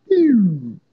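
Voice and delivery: sharp falling "pew" sound effect; imitated pew. Boasting after unplugging someone, a mimicked pew.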